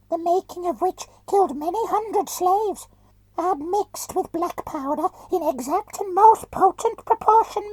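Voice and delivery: very high, whining voice through a mask; whining muffled voice. Acted. Companion describes the bomb.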